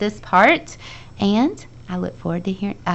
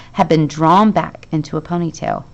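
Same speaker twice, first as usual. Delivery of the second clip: plain reading voice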